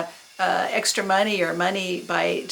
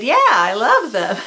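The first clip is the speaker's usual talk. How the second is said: eager fan reply; 4 semitones higher, 7 dB louder than usual. Retold or imitated. Teen eagerly answering punk stranger.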